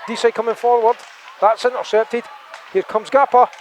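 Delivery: quick live hockey call; play-by-play. Acted. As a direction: call it as it happens.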